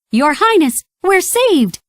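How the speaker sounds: high, excited squawk of relief; Donald crying out. A narrator's reading. Donald greets the prince in the dungeon, overjoyed. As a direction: burst out in joy at being rescued. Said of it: "Donald cried".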